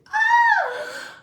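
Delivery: high excited squeal; a high giddy shriek. Retold or imitated. Excited squeal over first touch.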